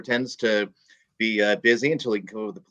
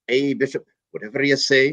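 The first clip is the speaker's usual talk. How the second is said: Irish priest; 5 semitones higher than usual. Retold or imitated. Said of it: "there's an old Irish priest".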